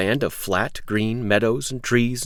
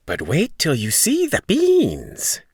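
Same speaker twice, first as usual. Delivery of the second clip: put-on slow, teasing old stranger; tempting old man; 8 semitones higher, 3 dB louder, slower than usual. Old man tempting Jack with beans, slowly.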